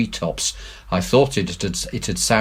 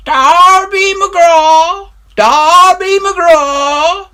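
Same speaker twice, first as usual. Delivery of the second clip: very high, slow, loud distant wail; eerie far-off wail; ghostly wail; 11 dB louder, much slower than usual. A narrator's reading. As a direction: wail it like a faint far hail. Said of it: "best describes the sound".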